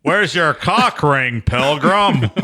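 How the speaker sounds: high, very slow cowboy impression; John Wayne. Comedian as cowboy star talking to his wiener, drawn-out impression. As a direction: draw it out like a western hero. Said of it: "like, a John Wayne".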